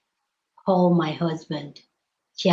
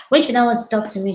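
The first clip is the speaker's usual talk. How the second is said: ordinary delivery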